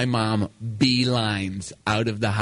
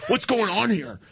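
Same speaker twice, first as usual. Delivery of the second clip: higher, agitated questioning; lightly voiced dad; 7 semitones higher than usual. Dad phones his son about mother's calls, mildly hammed-up demanding. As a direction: put on a slightly hammed-up demanding father. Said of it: "My dad calls now, and he's like".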